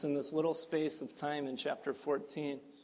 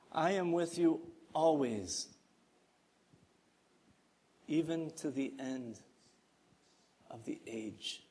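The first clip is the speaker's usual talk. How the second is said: tender promise; 5 dB quieter than usual. Shown as a demonstration. Pastor reading Jesus' promise, softly and tenderly. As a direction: read it gently, like a promise to keep. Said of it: "Listen to this promise".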